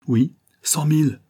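vive réplique emportée, plus aiguë; lâcher ça avec emportement; emporté. A narrator's reading. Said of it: "répondit l'inconnu avec emportement". (réponse emportée et vive)

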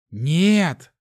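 high, loud desperate cry; loud cry of protest; hoarse protest. A narrator's reading. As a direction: croak out a desperate refusal. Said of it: "захрипел я".

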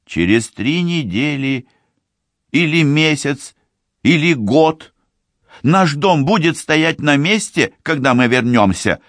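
put-on high, insistent young woman's voice; lightly voiced insistent sister; man doing a woman's voice. A narrator's reading. Tsibi answers her sisters.

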